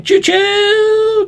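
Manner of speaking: high, long-held "choo-choo" whistle; train whistle. Pretend. Playing on a name that sounds like a train, train whistle. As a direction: toot it like a steam train, holding the last vowel. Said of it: "sounds a bit like a train".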